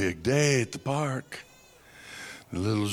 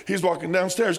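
usual speaking voice

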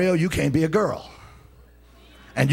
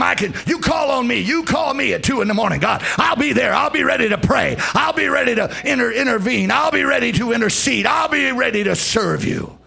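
shouted prayer vow; 7 semitones higher than usual. Acted.

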